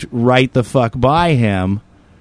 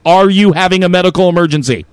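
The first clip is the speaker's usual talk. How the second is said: mock question; 5 semitones higher, 5 dB louder than usual. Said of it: "do I just stop them and go".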